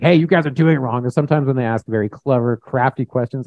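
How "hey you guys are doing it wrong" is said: mock aggressive call-out, higher voice; playing a rough, scolding Pharisee. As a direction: bark an acted accusation. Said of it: "rough, was very aggressive".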